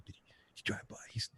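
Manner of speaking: hushed, unvoiced paranoid whisper. Stoned driver whispering about the passing cop, paranoid whisper. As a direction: whisper it like the cop might hear. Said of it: "I'm like".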